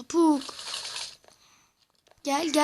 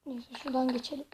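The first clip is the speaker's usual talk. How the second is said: ordinary delivery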